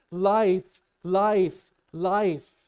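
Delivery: high, very loud shouted cries; screaming. Acted. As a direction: shout each word out like a desperate runner. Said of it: "you know what he's screaming?".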